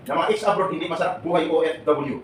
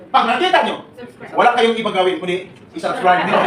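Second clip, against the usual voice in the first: louder, laughing through the request; laughing plug; 4 semitones higher, 8 dB louder than usual. Real, not acted. Speaker urging the crowd to subscribe, laughing. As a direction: push the subscribe ask with a laugh.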